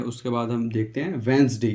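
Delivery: ordinary delivery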